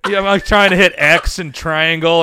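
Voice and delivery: loud, frantic button-mashing panic; amped-up retelling of a game panic; frantic gamer. Retold or imitated. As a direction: yell it like you're failing a QuickTime event. Reliving frantic QuickTime button mashing.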